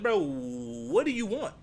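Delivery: slow, loud, exasperated bro what do you want; fed-up slow question; exasperated ask. Retold or imitated. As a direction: drag it out like you're fed up. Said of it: "If I was over here, that's what I ask".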